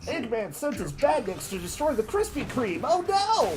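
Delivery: cartoon Sonic voice. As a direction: hype it up like a kids' cartoon.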